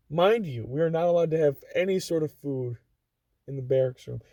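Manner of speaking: usual speaking voice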